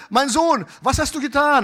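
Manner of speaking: high, loud acted reproach; alarmed father confronting. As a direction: call out sharply as a father taking his son to task. Acted father confronting son by phone.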